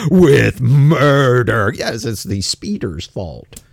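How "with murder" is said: very slow, drawn-out, loud verdict; mock dramatic. Host mimicking online commenters demanding a charge, mock dramatic. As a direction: stretch each word out with heavy mock gravity.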